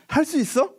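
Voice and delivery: 높이 올려 솔깃하게 묻기; 여지를 주는 예수님의 질문 흉내; 기대에 찬 질문. Retold or imitated. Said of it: "라고 했다면". (높고 솔깃한 물음)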